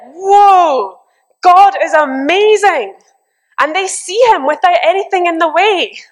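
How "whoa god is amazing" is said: higher, louder, amazed whoa; awed exclamation. Retold or imitated. Elders overwhelmed before God, amazed and loud. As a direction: gasp it out in wonder. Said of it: "people are like".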